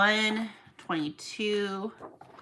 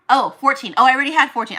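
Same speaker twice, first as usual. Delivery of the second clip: loud and higher sudden oh; realization; 4 semitones higher, 11 dB louder, faster than usual. Real, not acted. Miscounting comics, she suddenly realizes the error. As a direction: catch your own mistake out loud.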